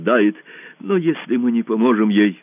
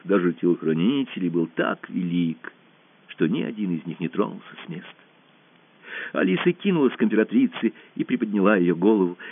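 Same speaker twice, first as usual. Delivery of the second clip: ordinary delivery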